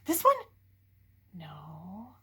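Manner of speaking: whispered no. Real, not acted. Reader answering her own question to the child, whispered. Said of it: "hushed answer".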